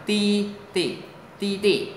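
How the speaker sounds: "ti, ti" starting high with a firm fall; emphatic falling tone on "ti", then a short second; stressed fourth tone. Shown as a demonstration. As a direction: hit the first "ti" from high up. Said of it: "Thanh bốn, nhấn mạnh".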